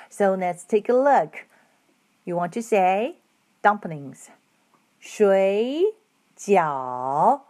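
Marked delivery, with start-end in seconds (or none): 5.0-7.4 水 and 饺 each dipping down then rising; 水饺 said as shuǐjiǎo, both tones dip and rise; third tones. Shown as a demonstration. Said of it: "Both words are third tones".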